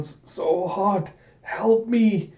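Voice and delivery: slow, high-pitched cry for help; drawn-out mock agony; dying plea. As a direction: voice a melting soldier begging. Soldiers melting from the heat.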